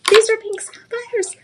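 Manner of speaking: high, slow gushing over the stones; excited gush. Real, not acted. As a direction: swoon over the pink sapphires.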